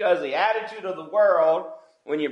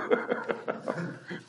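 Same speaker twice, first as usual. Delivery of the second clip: breathy chuckle; soft laugh; 11 dB quieter than usual. Real, not acted. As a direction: chuckle at the joke. Laughs at his own joke.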